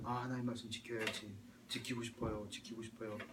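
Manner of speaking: murmured inner wish, low and soft; soft earnest resolve. Acted. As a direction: voice a quiet promise to oneself in prayer. Acted inner wish during prayer. (나직하게 다짐하듯)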